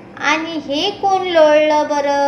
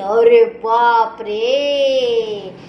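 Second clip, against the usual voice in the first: mock amazement; 4 semitones lower, much slower than usual. Mock awe at jungle animals.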